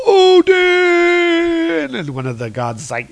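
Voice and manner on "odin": very high, loud, drawn-out yell; hammed-up heroic holler, pitched way up; mock battle shout. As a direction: cry out the name, stretched long. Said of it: "he gives out some shouts".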